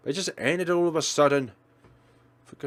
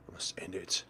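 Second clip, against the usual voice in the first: hushed, softer whisper; whispered resolve; 9 semitones lower, 14 dB quieter than usual. Acted. The heroine resolving to end it, whispered. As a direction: breathe it out as a grim decision.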